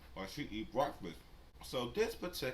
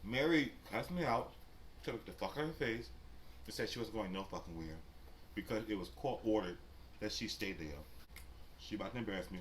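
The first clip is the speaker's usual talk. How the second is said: normal talking voice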